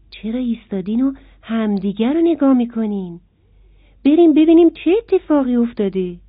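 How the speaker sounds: a small eager bee calling the others. A narrator's reading. Bee urging the stopped insects to go and look, eager.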